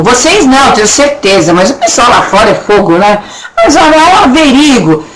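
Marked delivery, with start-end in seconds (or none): 3.6-5.0 laughing, higher "averigo" with the U dropped; mock wrong pronunciation. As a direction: tease the sloppy "averigo", laughing. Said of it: "Só que a pronúncia correta é essa".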